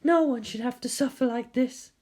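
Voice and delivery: a hint of a pitying nurse; lightly voiced nurse. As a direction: nurse grieving over the sleeping girl. Nurse speaks after whispering.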